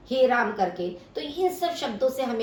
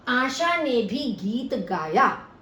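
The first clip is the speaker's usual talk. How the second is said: slow, आशा stressed; 2 semitones higher, slower than usual. Shown as a demonstration. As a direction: lean on आशा. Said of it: "भी शब्द से आशा के ऊपर विशेष बल". (आशा पर ज़ोर देकर धीरे)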